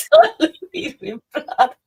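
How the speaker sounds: high, loud helpless laughter through the words; cracking up, barely getting it out; laughing hard. Real, not acted. Friend laughing at the bloody kebab story.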